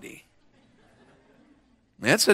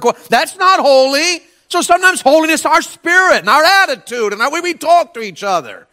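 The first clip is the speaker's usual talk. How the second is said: high, loud pulpit preaching; fiery preaching; 12 semitones higher, 7 dB louder than usual. Acted. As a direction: preach it out with full fire. Preacher at full force.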